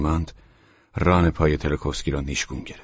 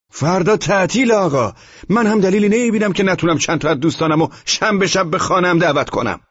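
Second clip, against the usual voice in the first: loud, very high-pitched protest; heated, high-voiced argument; shouted retort; 14 semitones higher, 6 dB louder than usual. A narrator's reading. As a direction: yell back in your own defence. Tenant shouts back in quarrel.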